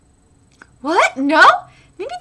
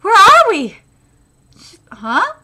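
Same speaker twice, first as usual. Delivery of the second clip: normal talking voice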